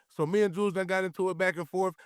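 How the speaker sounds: ordinary delivery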